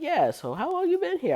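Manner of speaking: guy at the urinal making small talk, high goofy voice; mock casual guy. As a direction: play the chatty guy at the urinal. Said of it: "He's like".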